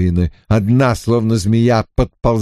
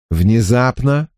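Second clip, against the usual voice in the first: plain reading voice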